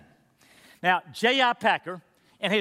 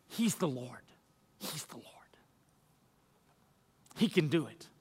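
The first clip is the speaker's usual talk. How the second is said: soft then whispered low; fading to whisper; 5 semitones lower, 9 dB quieter than usual. Acted. Pastor assuring the church, fading into a whisper. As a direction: let it fade into a breath.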